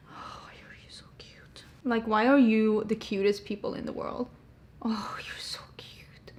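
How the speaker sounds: soft cooing whisper to the cat and dog; whisper to pets. Real, not acted. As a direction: breathe it softly to your pets.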